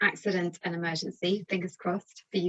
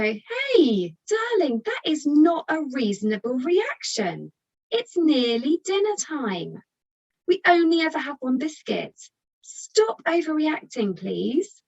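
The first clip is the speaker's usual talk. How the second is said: high, loud, scolding reasonableness; lecturing parent; 7 semitones higher, 4 dB louder than usual. Shown as a demonstration. Parent lecturing an upset child, loud and preachy. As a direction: talk down to the child, pitch raised and louder. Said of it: "if you stand there and go".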